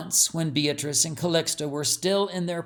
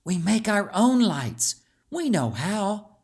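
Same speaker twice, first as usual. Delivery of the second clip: put-on confident young girl; 6 semitones higher than usual. A narrator's reading. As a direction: reassure your friend you both can do it. Calixta reassures friend.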